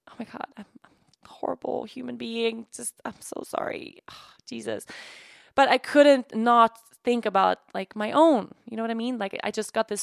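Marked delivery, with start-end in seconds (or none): 0.1-4.8 real whispered self-reproach, softer; ashamed whisper. Real, not acted. Mom confessing guilt to her viewers, ashamed whisper. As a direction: breathe it out in shame.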